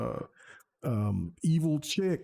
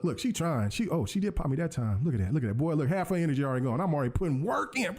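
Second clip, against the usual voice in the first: ordinary talk, nothing marked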